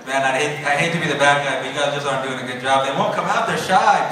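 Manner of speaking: ordinary talk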